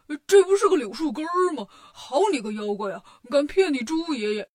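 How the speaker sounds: loud burst of Pigsy's indignation; indignant outburst. A narrator's reading. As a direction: blurt it out, outraged at the trick. Bajie discovers he was tricked.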